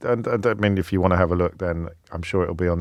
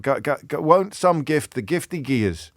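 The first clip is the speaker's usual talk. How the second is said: lifted-pitch Scots poetry recitation; Scottish accent; 5 semitones higher than usual. Acted. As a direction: have a go at quoting Burns in a Scottish accent. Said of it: "compliments on my Scottish accent".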